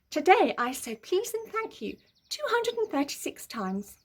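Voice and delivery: high, prissy goody-goody voice; smug Peter. A narrator's reading. Peter boasting in his diary, prissy and high. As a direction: boast it sweetly.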